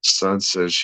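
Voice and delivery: ordinary delivery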